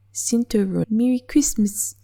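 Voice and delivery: attempted New Zealand accent, loud and high; a quick stab at a Kiwi accent. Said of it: "Can't do a Kiwi accent".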